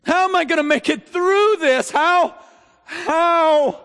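high, loud, slow pleading how; anguished lament shouted to heaven; desperate lament. Acted. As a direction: cry out how in despair. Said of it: "the word how".

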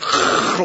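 voiceless throaty burst of thunder; imitated lightning crackle. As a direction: make a movie lightning-strike noise.

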